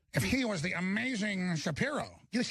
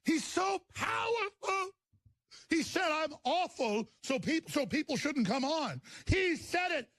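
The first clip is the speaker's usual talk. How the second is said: simpering parody; high mocking voice sneering at the rival's fans; mock fawning; 9 semitones higher than usual. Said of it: "mocking Shapiro fans".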